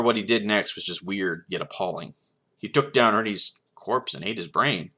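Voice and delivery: ordinary delivery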